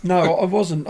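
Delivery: ordinary delivery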